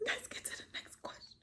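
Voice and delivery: hushed whisper, moving things along; whispered aside. Real, not acted. Host whispers to viewers before the next question. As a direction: whisper it as if nobody should hear.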